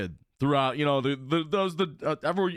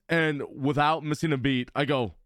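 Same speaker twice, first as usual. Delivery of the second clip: ordinary delivery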